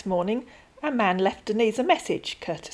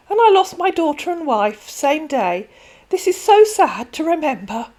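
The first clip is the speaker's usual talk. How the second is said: higher, louder put-on sorrowful scammer; scammer's voice; 8 semitones higher, 7 dB louder than usual. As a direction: fake sadness to win sympathy.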